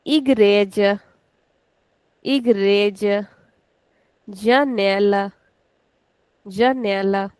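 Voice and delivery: igreja and janela slow, second last syllable stretched; slow model words. Shown as a demonstration. Teacher reading Portuguese words slowly for students. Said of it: "We'll put on the second last".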